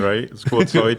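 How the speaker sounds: loud mock-Canadian "outside"; Canadian accent. Shown as a demonstration. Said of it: "go all Canadian".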